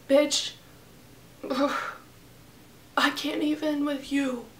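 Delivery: soft, sighing exasperation; exasperated. Retold or imitated. Telling the roommate off after the fire. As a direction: sigh it out, done with her. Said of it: "I'm like".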